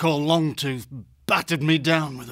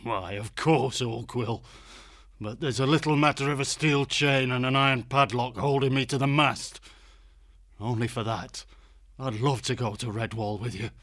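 bitter irony; 4 semitones higher, 3 dB quieter than usual. Acted. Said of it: "tinged with bitter irony".

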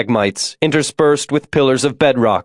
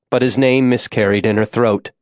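plain reading voice

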